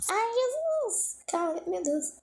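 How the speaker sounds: high, slow, drawn-out wail of panic; frantic high wail, half laughing at herself; frantic cry. Real, not acted. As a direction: cry out as time runs away. Panicking over the running timer.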